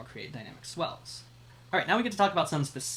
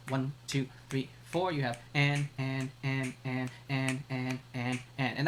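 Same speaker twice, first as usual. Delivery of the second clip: rhythmic ands pushed off the beat; offbeat ands; slower than usual. Shown as a demonstration. Teacher demonstrates syncopation for the class, accented offbeats. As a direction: chant the and on every offbeat. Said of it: "accenting the offbeats".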